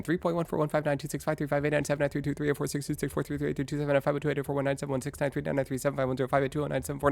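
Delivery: high, breakneck stream of numbers; rapid-fire recital. Real, not acted. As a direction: rattle off digits very fast. Speed-tests transcription with pi digits.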